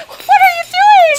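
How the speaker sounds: high, loud frightened cry; terrified shriek of disbelief; panicked shout. Acted. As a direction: scream it in terror as you're strapped down. Restrained, panicking victim.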